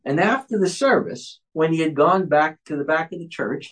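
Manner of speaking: ordinary talk, nothing marked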